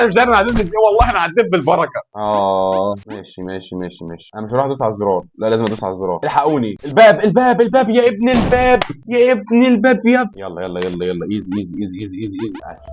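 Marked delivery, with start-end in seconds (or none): 0.0-2.0 high, laughing disbelief; relieved laughing. Real, not acted. Gamer laughing he somehow made it, high relieved. Relieved after surviving the level. 6.2-10.4 very high loud yelling; panicked shout. Real, not acted. Gamer yelling at the door to teammates, high panicked shout.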